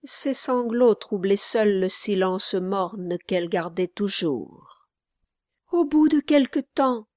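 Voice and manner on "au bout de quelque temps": put-on high-pitched, earnest child; little boy. A narrator's reading. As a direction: speak up in a small boy's voice. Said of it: "poursuivit le petit garçon".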